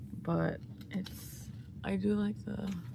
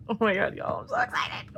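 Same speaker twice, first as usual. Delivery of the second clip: excited squeal; 4 dB louder, faster than usual. Real, not acted. Fan opening her album squeals with excitement. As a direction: gush like a thrilled fan. Unboxing a K-pop album, thrilled.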